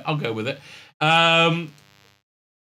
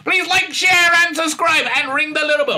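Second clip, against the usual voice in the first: loud high outro shout; higher, louder YouTube outro; announcer outro; 13 semitones higher, 5 dB louder than usual. Acted. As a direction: belt the sign-off cheerfully. Outro sign-off.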